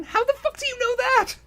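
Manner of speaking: real baffled squeal; incredulous. Real, not acted. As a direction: shriek it in disbelief. Incredulous outburst at plot.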